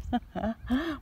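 short, airy excited laugh; breathless laugh. Real, not acted. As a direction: laugh while catching breath. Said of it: "I'm so excited".

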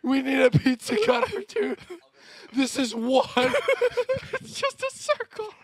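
very high, laughing through it; can barely get it out for laughing; real giggling disbelief. Real, not acted. Laughing about pizza cutter.

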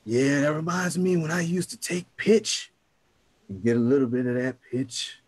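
ordinary delivery

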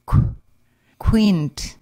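"qu-, quint." with a rounded kw at the start; "qu-" as k with rounded lips before "quint."; kw sound demo. Shown as a demonstration. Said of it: "as in the word queen".

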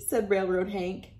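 plain reading voice

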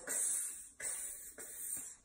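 "Ks" as a soft voiceless k with a hiss; letter sound demo. Shown as a demonstration. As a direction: sound out "Ks" with no vowel. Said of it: "Xavier Fox says".

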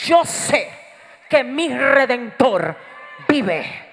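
loud, slow, spaced-out affirmation; forceful declaration of faith. Acted. Believer who lost a job declaring faith, forceful declaration. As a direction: declare it firmly, phrase by phrase. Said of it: "ellos dicen, "Yo sé".

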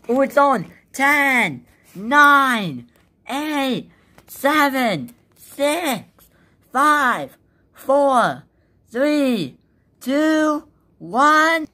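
high excited countdown; new year countdown crowd. Real, not acted. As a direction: count down loud and eager.

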